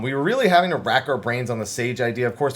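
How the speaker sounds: ordinary delivery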